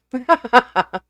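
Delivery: bursts out laughing; a real laugh breaks out; sudden amused laugh. Real, not acted. Reader laughs at her own slip.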